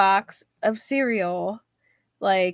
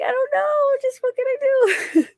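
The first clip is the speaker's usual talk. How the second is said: high, helpless whine with a laugh; whiny patient. Retold or imitated. Retelling her own reply to the dentist, high laughing whine. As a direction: play yourself as a squeaky, helpless patient. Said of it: "I'm like".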